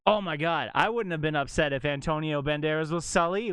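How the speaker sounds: ordinary talk, nothing marked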